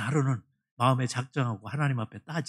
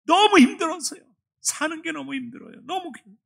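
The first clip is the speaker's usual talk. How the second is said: pouring out grief; 13 semitones higher, 6 dB louder than usual. Retold or imitated. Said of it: "입술이 열리기 시작했어요".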